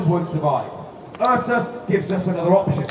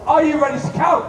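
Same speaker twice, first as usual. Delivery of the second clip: high, bright call to the crowd; rally the audience cheerfully; overbright kids party host; 9 semitones higher, 5 dB louder than usual. Acted. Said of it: "Come on, that was rubbish".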